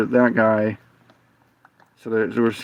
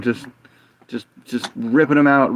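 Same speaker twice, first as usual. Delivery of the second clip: ordinary delivery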